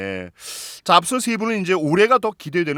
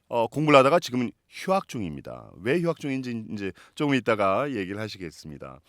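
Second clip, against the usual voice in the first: announcer voice; 4 semitones higher, 4 dB louder than usual. Acted. Host introduces a guest.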